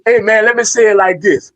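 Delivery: ordinary delivery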